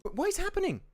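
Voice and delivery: high, drawn-out disbelief; baffled. Real, not acted. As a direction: ask it in high-pitched confusion. Feed breaks again mid-fight.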